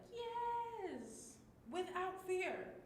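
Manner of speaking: high, joyful and slow; mega happy. Shown as a demonstration. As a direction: sing out the joy of being free. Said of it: "add another mega happy face".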